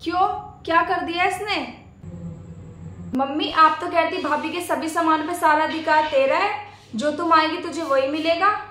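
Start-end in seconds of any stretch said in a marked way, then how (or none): none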